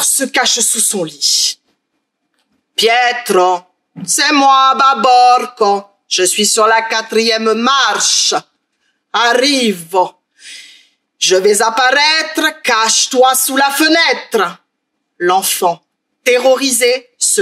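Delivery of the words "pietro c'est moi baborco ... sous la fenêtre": ogre creeping up the stairs, slow and drawn out; slow menacing ogre. Acted. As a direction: drag each word, sinister and patient.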